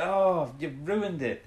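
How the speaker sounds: hammed-up scientist upset over spoiled slides; mock annoyance. Scientist scolding Joe for ruining his slides, mock annoyed. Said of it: "he gets really annoyed".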